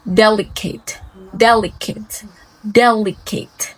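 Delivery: delicate stressed on del, ending in a short "kit"; adjective stress. Shown as a demonstration. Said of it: "It's adjective".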